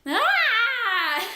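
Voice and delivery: a high, loud laughing scream; horrified shriek. Retold or imitated. Freaked out by the sisters, a laughing scream. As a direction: shriek as if spooked, then laugh. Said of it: "I was just like".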